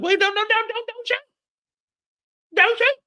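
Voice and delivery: high, frantic begging; hammed-up terrified plea, very high; panicked pleading. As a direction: beg for your life in a squeaky panic. Anya begging Kane at gunpoint.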